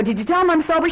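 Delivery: very high, loud mock pleading yell; frantic insistence. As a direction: holler it desperately down the phone. Retold frantic plea from jail.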